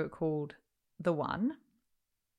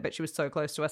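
usual speaking voice

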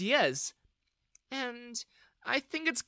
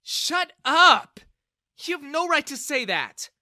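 very high, loud protest; angry outburst; 13 semitones higher, 12 dB louder, slower than usual. A narrator's reading. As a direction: shout him down in defence of someone. Bella intervenes in quarrel.

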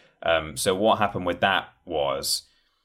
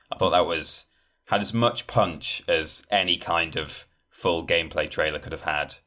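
ordinary talk

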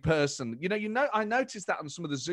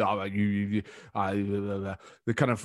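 low background chuntering; Boris; 6 semitones lower than usual. Retold or imitated. Impressionist showing how you start off, low chuntering. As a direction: chunter under the words in half-formed bits. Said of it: "borderline chuntering".

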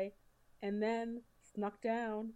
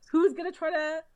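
ordinary talk, nothing marked

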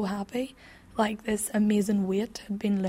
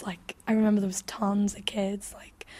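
usual speaking voice

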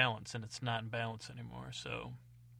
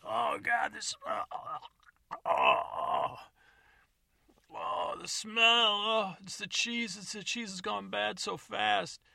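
much higher, slow, louder gagging moans; retching disgust; 8 semitones higher, 4 dB louder, slower than usual. Acted. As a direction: choke out the words through a horrid stink. Choking on the smell.

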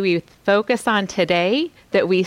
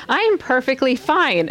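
mock breezy denial; 7 semitones higher than usual. Mock stressed person insisting fine.

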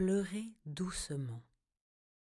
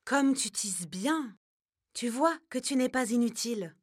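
praising grandma bear; 6 semitones higher, 5 dB louder than usual. A narrator's reading.

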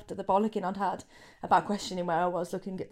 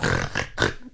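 startled gasp. Retold or imitated. Mocking wife waking up.

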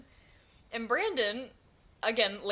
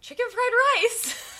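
much higher and louder wail; retold exclaimed answer; 13 semitones higher, 9 dB louder than usual. Coworker naming his stolen lunch, hammed-up wail. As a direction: cry it out like the answer is a tragedy. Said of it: "He goes".

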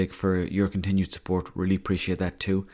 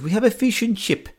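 mock Italian accent; 14 semitones higher, 5 dB louder than usual. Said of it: "and he goes".

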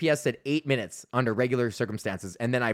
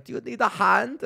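put-on European accent; 8 semitones higher than usual. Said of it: "some kinda European accent".

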